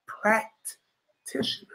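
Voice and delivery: "Practitioners" breathed out unvoiced, very slow and soft; hushed mocking echo; whispered repeat. Shown as a demonstration. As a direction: whisper "Practitioners" to yourself, drawn out.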